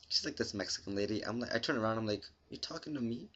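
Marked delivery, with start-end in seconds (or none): 2.5-3.3 muttered softly, much quieter; under my breath. Retold or imitated. Muttered to himself at the store clerk, quietly under his breath. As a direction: say it under your breath to yourself. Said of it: "I say this under my breath".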